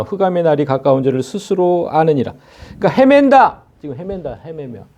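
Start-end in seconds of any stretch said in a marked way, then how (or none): 2.8-3.6 헤맨다 hit high and loud, then falling; stressed 헤맨다. Real, not acted. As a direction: lean hard on 헤맨다. Preacher stressing the scripture word.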